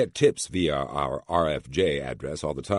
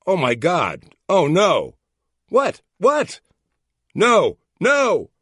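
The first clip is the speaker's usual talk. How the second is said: hammed-up alarm, much higher and louder; mock panic; 10 dB louder, much slower than usual. Boss faking a lab accident to prank the caller, hammed-up panic. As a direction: fake a disaster on the phone.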